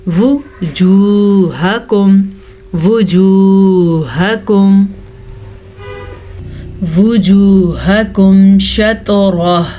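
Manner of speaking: slow recitation, short वाओ in वुजूहकुम; correct recitation. Shown as a demonstration. Teacher repeating the correct reading. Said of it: "हमने पढ़ना है".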